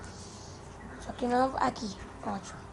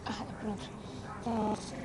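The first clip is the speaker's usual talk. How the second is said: ordinary talk, nothing marked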